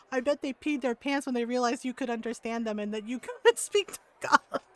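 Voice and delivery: breaking into laughter near the end; laughing. Retold or imitated. Mom hearing what happened to the rude ladies, laughing. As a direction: retell it cracking up as the line goes on. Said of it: "my mom was laughing".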